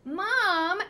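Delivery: put-on high, loud child's yell; shrill call across the house; yelling for mom. A narrator's reading. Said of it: "Danny Budwing yelled".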